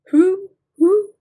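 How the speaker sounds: high, loud owl hoots. A narrator's reading. Teacher reading animal talk to children, as an owl. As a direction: hoot like an owl. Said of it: "said the owl".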